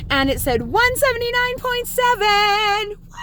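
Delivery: high-pitched thrilled reveal; excited announcement. Real, not acted. Vlogger revealing the scale number to viewers, thrilled. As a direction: build the number up to an excited squeal. Announces a long-awaited weight milestone.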